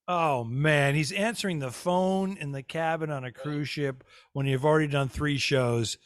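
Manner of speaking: usual speaking voice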